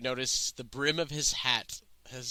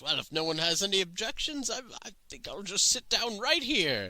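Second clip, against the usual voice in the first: put-on higher-pitched, hesitant old wanderer; befuddled old man; 7 semitones higher than usual. Old man asking the campers to let him sit, befuddled and high. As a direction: voice a harmless old traveler settling in, pitched up.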